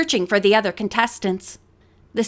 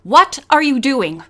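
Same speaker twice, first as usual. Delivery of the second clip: demanding; 3 semitones higher, 5 dB louder than usual. Acted. Woman demanding Tom.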